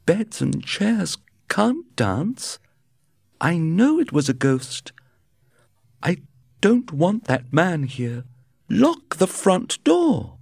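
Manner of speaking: high, slow, frightened wife. A narrator's reading. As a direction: plead to lock the door, scared. Wife frightened after the haunting.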